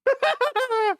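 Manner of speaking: sudden very high laugh. Real, not acted. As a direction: burst out cackling. Bursts out laughing.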